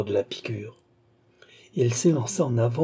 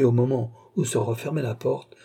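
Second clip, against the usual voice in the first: usual speaking voice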